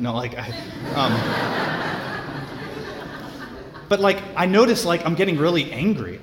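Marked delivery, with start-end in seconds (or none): 1.2-3.8 a quiet airy chuckle; soft breathy laugh. Real, not acted. Preacher laughing at his own joke, a quiet airy chuckle. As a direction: laugh under the breath at yourself.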